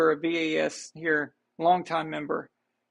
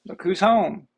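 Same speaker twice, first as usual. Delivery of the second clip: bright, louder greeting to someone arriving; welcoming someone home; 2 semitones higher, 6 dB louder than usual. Real, not acted. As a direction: call out happily as they walk in. Someone arrives home during stream.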